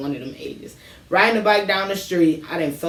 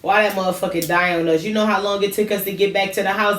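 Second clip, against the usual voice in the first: ordinary delivery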